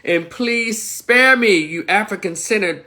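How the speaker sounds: usual speaking voice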